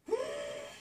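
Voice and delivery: sharp high intake of breath in delight; delighted high gasp. Real, not acted. Crafter unboxing gift lace, high delighted gasp. Sees another lace piece.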